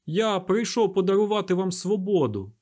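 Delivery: high, loud public address; proclamation. A narrator's reading. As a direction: proclaim it to a whole crowd. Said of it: "звернувся до близнюків дикун".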